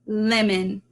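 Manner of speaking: ordinary delivery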